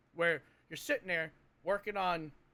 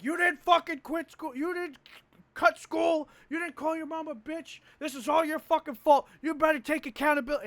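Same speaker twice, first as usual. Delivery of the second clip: high, loud berating yell; 8 semitones higher, 4 dB louder than usual. Retold or imitated. Imitating the seminar leader yelling at recruits, loud berating. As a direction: tear into them, shouting. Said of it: "a dude yelling".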